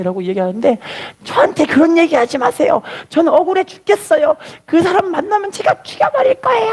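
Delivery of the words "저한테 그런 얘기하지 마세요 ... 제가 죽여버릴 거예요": acted high-pitched protest building to a shout; anguished outburst. Son rejecting mother's plea to forgive, anguished outburst. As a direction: imitate a tormented son, voice climbing very high. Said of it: "너무 괴롭게 이야기를 하는".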